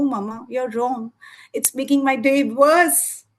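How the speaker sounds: usual speaking voice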